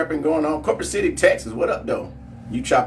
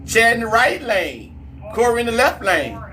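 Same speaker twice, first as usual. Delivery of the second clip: excited, higher play-by-play hype; race hype; 9 semitones higher, 3 dB louder than usual. Acted. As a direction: pump the chat up for the next race. Calls the drag race live.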